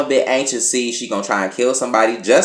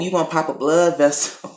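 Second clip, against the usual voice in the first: ordinary talk, nothing marked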